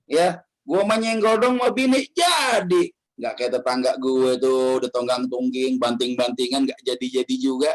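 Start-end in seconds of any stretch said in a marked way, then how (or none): 0.6-2.9 higher, slow smug bragging; boastful husband quote. Retold or imitated. Husband repeats his boast to the preacher, higher slow smug drawl. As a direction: drag out the brag, pitched up.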